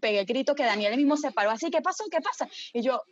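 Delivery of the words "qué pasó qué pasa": woken confused. Retold or imitated.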